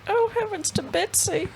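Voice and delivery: put-on high, slow little old lady. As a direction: quaver it out like a sweet granny.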